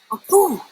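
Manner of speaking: loud high sneeze; big sneeze. A narrator's reading. The great fish sneezes Verdi away, loud sneeze. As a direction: sneeze it out with a blast.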